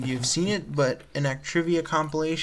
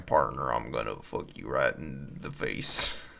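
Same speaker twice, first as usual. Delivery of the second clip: deep cowboy voice; 11 semitones lower than usual. Acted. Mock cowboy threat.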